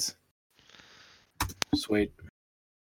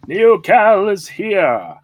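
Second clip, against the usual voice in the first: announcer voice; 8 semitones higher, 4 dB louder, much slower than usual. Pretend. Hyping up cohost's introduction.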